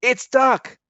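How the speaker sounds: joyful shriek. A narrator's reading. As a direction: burst through the door shrieking. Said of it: "they shrieked".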